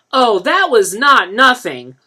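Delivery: a mother cutting in sharply; chimed in. A narrator's reading. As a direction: Claudette correcting the boy. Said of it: "Claudette chimed in".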